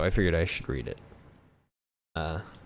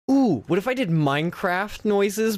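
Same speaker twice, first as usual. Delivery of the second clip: excited; 12 semitones higher, 5 dB louder, slower than usual. Real, not acted. Sudden excited idea.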